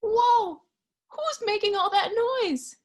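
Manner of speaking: high loud startled whoa. Acted. Reading to children, high startled surprise. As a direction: jump in surprise at the noise.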